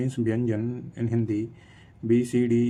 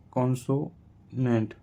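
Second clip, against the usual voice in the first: "consonant" drawn out in slow, separate parts; syllable by syllable; much slower than usual. Shown as a demonstration. Teacher spelling out that Y is a consonant, slow syllable by syllable. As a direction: break "consonant" with a pause before the last syllable. Same word broken into parts.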